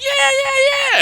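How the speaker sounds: high, loud mocking cheer; mock crowd yelling yeah. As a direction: imitate a jeering crowd. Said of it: "they're like".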